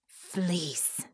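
villain's drawn-out, venomous spit; spat out. A narrator's reading. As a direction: spit the word out with contempt. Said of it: "Baron spat".

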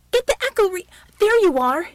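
high, loud call breaking off; yell, cutting yourself off on spotting him; urgent shout. A narrator's reading. Shaken man spotting the helper, shouting.

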